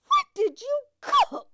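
high, loud, slow auntie voice; elderly aunt voice. Retold or imitated. Aunt asking niece about supper, high slow voice. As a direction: play the nosy old aunt asking.

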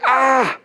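high exasperated "ah"; short high-pitched mock yell; frustrated cry. King hearing yet another blessing, high frustrated cry. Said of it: "He's like".

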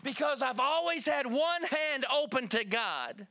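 fervent preaching. Real, not acted. Testimony rising into full preaching.

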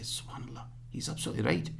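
breathy whisper of sudden realisation; awed whisper. Retold or imitated. Whispering in awe after the doctor's lesson. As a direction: whisper it in humbled wonder.

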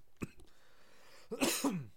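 second harsh throat clearing; choking on a drink. Real, not acted. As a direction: clear the throat again, still choked on a sip. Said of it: "Da schon wieder".

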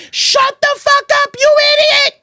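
high shouted rebuke at the dog; yelling. Retold or imitated. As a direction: yell at the dog from the stairs. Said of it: "I just was yelling at him".

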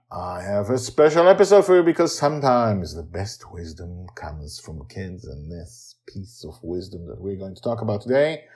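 ordinary delivery

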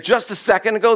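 normal talking voice

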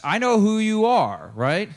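slow, high, insistent declaration; emphatic confrontation. Acted. Peter insisting to Jesus that he knows him, emphatic. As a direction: stretch it out, high and forceful.

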